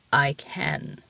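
low, rumbling mountain giant; thunderous giant voice. A narrator's reading. The mountain answering Ramona without deafening volume, deep giant voice. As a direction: speak deep, like distant thunder. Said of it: "conversation with a thunderstorm".

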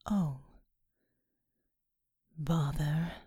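low, soft otherworldly voice; deep angelic being. Acted. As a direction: speak as a vast gentle angel. Seraphim speaks.

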